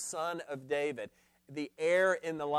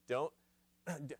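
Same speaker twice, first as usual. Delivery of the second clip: normal talking voice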